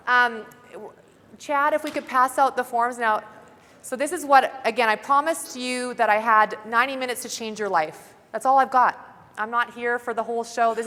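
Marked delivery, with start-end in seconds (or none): none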